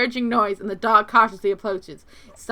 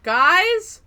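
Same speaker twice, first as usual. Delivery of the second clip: pleading; 7 semitones higher, 5 dB louder, much slower than usual. Real, not acted. Pleading with chat.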